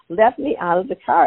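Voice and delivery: half-mock panic to be let out; scared plea. Mom in the back seat begs her sons, mildly scared. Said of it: "And I got scared".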